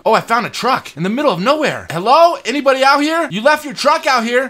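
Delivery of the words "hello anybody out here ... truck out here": calling out into the dark, loud and high; raised calling voice. Real, not acted. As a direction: shout to anyone nearby. Calling out to the owner.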